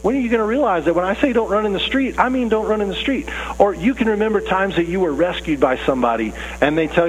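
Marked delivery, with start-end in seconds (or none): 0.0-3.3 higher-pitched, pleading parental scolding; acted relieved-but-rattled parent reprimand; exasperated parent. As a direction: plead with a child just rescued from danger. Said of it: "you don't wanna scare 'em more".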